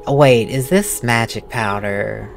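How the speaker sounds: ordinary talk, nothing marked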